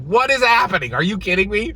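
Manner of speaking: high and loud exasperated disbelief; mock disbelief. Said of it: "I was just like".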